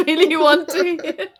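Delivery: laughing while narrating, high and loud; loud, high-pitched amused narration; real laughter. Real, not acted. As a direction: let the laugh break through the words. Narrator cracks up mid-sentence.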